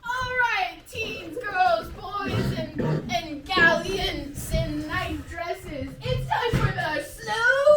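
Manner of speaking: announcer voice. Acted. Announcing the slow dance.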